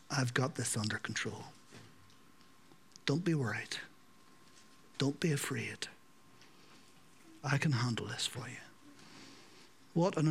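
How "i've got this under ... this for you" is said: soft reassurance. Acted. Jesus voiced calming fearful people.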